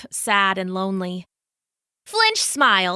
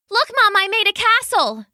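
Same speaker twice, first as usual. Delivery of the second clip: put-on little boy; 11 semitones higher, 4 dB louder than usual. Little boy shows his mother.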